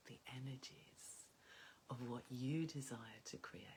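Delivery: hushed, slow, mystical whisper; soft dreamy whisper. Acted. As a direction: breathe the words out as if still dreaming.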